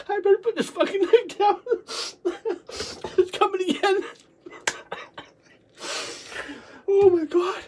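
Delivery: high, weepy laughing speech; helpless laughing fit. Real, not acted. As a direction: gasp out words between real laughs. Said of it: "It started me laughing again".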